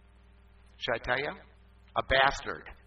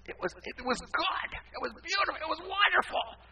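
high-pitched, hammed-up gushing; playfully overdone wonder, pitched way up; mock rapture. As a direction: marvel in a climbing high voice. Gushes over his cleaned office.

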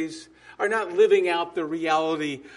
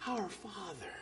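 "Our Father" spoken much softer, nearly breathed; hushed reverent "Our Father"; 17 dB quieter than usual. Shown as a demonstration. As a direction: murmur it reverently, with a falling close. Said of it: "start it this way".

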